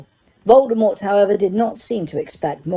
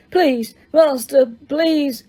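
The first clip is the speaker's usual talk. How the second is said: higher, slow, lightly moaned begging; moaning plea; 7 semitones higher, slower than usual. A narrator's reading. Wormtail begs his master, a mild slow moan. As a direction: plead weakly from the ground. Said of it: "moaned Wormtail".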